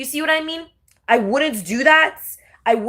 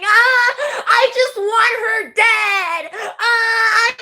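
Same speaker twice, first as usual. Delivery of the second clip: higher fake wailing sob, hammed-up; mock crying; 11 semitones higher, much slower than usual. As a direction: pretend to cry while wanting her dead.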